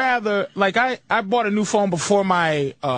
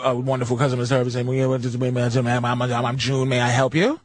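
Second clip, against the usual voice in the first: much lower, fumbling mock customer service voice; mock phone rep; 9 semitones lower than usual. Caller mocking a flustered customer service rep. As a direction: stumble like a flustered phone agent. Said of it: "Here's what I do now".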